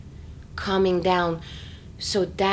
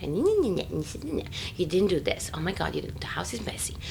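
nagging inner whisper; 7 dB quieter than usual. Retold or imitated. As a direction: hiss it like a critical inner voice. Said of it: "it's like a team".

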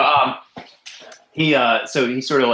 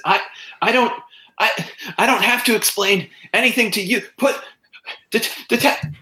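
high, stammering protest; frantic stammering man; 11 semitones higher than usual. Acted. Accused man defends himself to detective, high and frantic. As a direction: stumble over words in panic.

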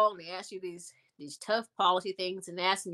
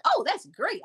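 imitating; 9 semitones higher, 6 dB louder than usual. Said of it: "she's like".

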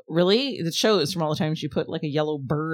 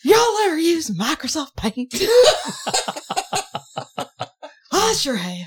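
high, hollered question, played for laughs; mock call-out; 10 semitones higher, 5 dB louder, slower than usual. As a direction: holler it slowly like a hammed-up heckler.

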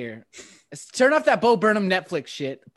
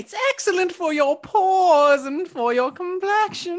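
witch voice; 7 semitones higher, slower than usual. Acted. Witch persona continues.

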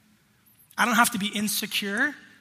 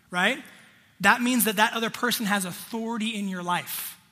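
ordinary talk, nothing marked

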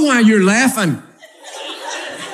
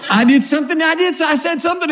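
higher, anxious pleading wife; 8 semitones higher, faster than usual. Acted. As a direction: coax out what you did wrong. Wife voiced in marital quarrel.

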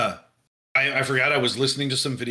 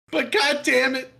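put-on higher, exasperated New York cop accent; 10 semitones higher than usual. Detective cursing about the case in a New York accent. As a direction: curse it like a fed-up Brooklyn detective. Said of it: "why we don't sound like we're from Indiana".

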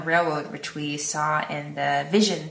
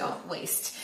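whispered aside; 12 dB quieter than usual. Retold or imitated. Retold dismissive aside.

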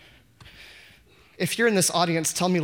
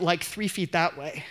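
usual speaking voice

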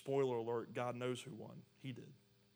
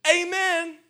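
very high, very loud "Amen"; triumphant shout; 19 dB louder than usual. Acted. Preacher mimics the cheer King David doesn't give, shouted. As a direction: shout it out at full voice.

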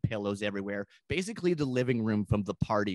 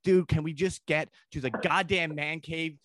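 climbing higher into a fed-up yell; impatient guest snapping at the De Niro doorman, mock yelling; mock exasperated yell; 7 semitones higher than usual. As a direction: lose your patience, pitch shooting up.

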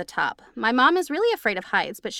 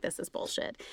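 hushed, breathy under-the-breath complaint; whispered grumble; 13 dB quieter than usual. Retold or imitated. Friend grumbling at the psychic reading, whispered. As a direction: mutter it so the psychic can't hear.

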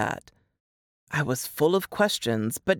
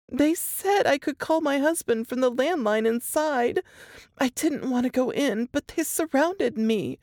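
higher, strained voice of a frightened woman; Laura reliving the horror, voice pitched up; shaken young woman; 9 semitones higher than usual. A narrator's reading. Laura telling Jess and Nate her ordeal, higher and shaken.

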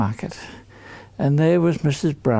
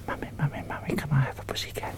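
put-on softer, fast whispered child's plea; whispered prompt; 11 dB quieter, much faster than usual. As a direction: whisper the line for the kids to repeat.

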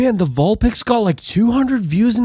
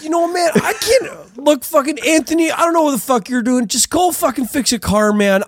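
higher-pitched, exasperated rant; fed-up outburst; 7 semitones higher than usual. Acted. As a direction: blow up at your roommate and storm off. Storming out at Anthony.

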